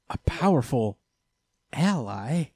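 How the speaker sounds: imitated doubtful echo, each word set apart; questioning echo. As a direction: repeat it as a puzzled question. Voicing her doubtful reply.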